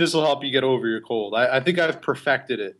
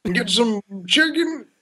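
much higher, put-on friend voice; Phil Lopez impression; 10 semitones higher than usual. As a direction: ask it like the goofy buddy. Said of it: "That's what he sounds".

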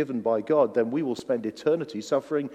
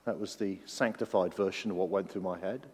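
normal talking voice

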